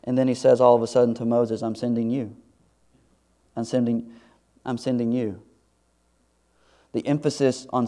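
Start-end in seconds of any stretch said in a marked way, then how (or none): 1.6-5.4 quiet commission. Acted. God quietly commissioning Moses.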